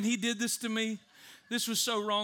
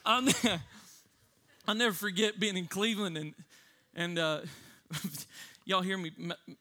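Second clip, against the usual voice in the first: ordinary talk, nothing marked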